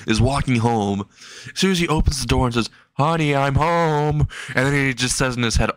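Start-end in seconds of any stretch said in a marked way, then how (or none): 2.9-4.3 higher, drawn-out call to a spouse; slow singsong greeting; homecoming call. Acted. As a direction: stretch it out like a sitcom arrival. Man calls out entering his house.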